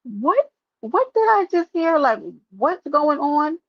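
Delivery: high, slowed-down mock shock; a hammed-up what-did-I-just-hear; stunned disbelief. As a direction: act floored by what you just heard. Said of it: "be like".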